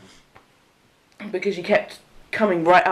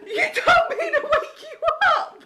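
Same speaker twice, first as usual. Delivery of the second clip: high laughing protest; laughing indignant reply, much higher; exasperated retort. Retold or imitated. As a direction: fire it back through laughter, pitched up. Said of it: "I was like".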